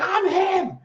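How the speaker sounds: high-pitched shouted player impression; hyped-up, high celebratory shout; imitated freak-out. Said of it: "starts freaking out".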